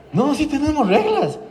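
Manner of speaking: high-pitched mock flustered insistence; nervous mother. Mamá temblando ante el terapeuta, nervios imitados. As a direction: imitate a rattled mum insisting. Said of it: "la mamá también así con, con Parkinson en el ojo, así temblando".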